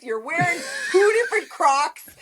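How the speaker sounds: high-pitched, breaking into laughter; mock them while cracking up; incredulous jeer through laughter; laughing mockery. Mocking commenter while cracking up.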